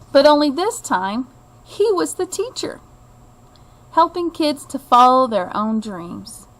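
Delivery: plain reading voice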